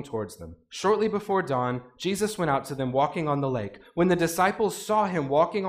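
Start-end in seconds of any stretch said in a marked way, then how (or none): none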